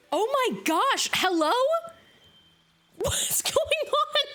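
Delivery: high, slow, delighted squeals; surprised excitement. Real, not acted. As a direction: greet the unexpected raid with real glee.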